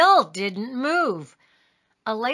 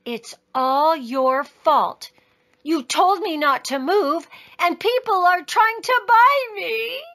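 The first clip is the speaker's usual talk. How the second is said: put-on upset child; 6 semitones higher than usual. A narrator's reading. Taya blames her father, getting tearful. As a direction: build from sulky blame to tearful protest.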